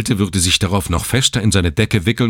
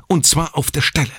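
higher, firm insistence; insistent; 10 semitones higher than usual. A narrator's reading. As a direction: press the point firmly. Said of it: "beharrte die Schwester".

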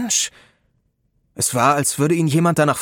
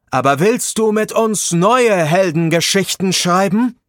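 louder, higher rousing call; rally him with arms raised; 6 semitones higher, 5 dB louder than usual. A narrator's reading. Leader with raised arms calls to Rob, louder and higher.

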